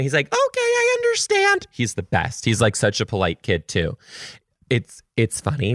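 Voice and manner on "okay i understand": put-on very high, level child's reply; little kid voice. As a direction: say it politely like a tiny kid. Voicing the child.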